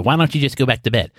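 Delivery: mock exasperated wife tone; a lightly imitated, annoyed "go back to bed". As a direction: say it like a fed-up wife, pointedly. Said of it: "you know, in that voice, the".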